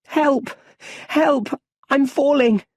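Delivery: high, loud panicked cry for help; a child screaming as he falls; terrified shout. Acted. Young Bruce falling into the well, screaming high and loud. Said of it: "He's shouting".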